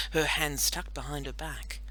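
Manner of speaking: ordinary delivery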